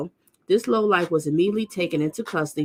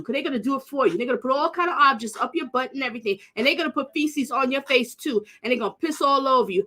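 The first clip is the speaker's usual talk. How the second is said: gloating taunt; 6 semitones higher than usual. Real, not acted. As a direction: taunt him with glee. Taunting the criminal directly.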